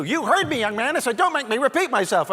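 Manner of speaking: high, loud exasperated mom; yelling mother. Retold or imitated. Mother to young man who claims not to hear, exasperated yelling. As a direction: holler it like a fed-up mother. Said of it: "we could hear her yelling at us".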